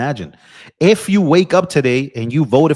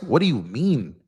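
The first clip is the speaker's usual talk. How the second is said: ordinary talk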